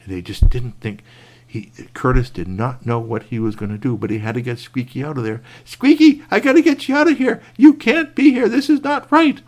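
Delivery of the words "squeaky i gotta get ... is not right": much higher, louder, insistent; urgent call. Acted. Curtis calling to trapped Squeaky, loud urgent high voice. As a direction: call out urgently to free him.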